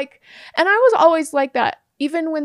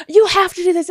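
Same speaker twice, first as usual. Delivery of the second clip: higher, louder demanding voice; higher-pitched demand, loud and pushy; mock angry lawyer; 6 semitones higher, 7 dB louder than usual. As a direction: imitate the lawyer yelling at you. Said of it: "And he's like".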